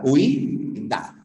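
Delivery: Huí and da said apart, with a clear break between them; Huí, pause, da, stress on the í; split syllables. Shown as a demonstration. Teacher splits the word into syllables for the student. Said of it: "No cuenta".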